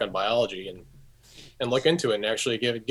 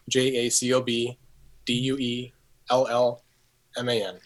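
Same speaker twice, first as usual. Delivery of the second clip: spelling slowly. Real, not acted. Spelling out email address.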